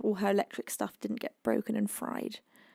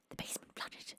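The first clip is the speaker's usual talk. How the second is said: shocked whisper; 20 dB quieter than usual. Real, not acted. Shocked at a friend's flood news.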